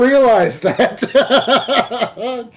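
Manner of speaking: mock-shocked teasing laugh; laughing disbelief. Real, not acted. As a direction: laugh at a friend's gaffe in disbelief. Teasing a friend over a gaffe.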